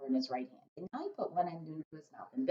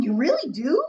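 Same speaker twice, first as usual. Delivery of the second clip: brightening giant; 9 semitones higher, 10 dB louder than usual. Acted. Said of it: "suddenly brightening".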